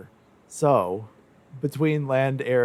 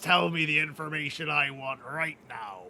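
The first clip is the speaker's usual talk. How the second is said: pirate voice; 2 semitones higher, 6 dB louder than usual. Pretend. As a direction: threaten like a pirate captain. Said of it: "talk like a pirate".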